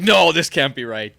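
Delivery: put-on bewildered old German guy protesting loudly; loud German dad. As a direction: boom it out, slowly, like a confused German dad. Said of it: "in his German accent".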